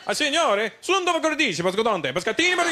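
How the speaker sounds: high, animated gibberish Italian; mock Italian. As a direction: babble excitedly in high-pitched mock Italian. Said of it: "they started speaking Italian to me".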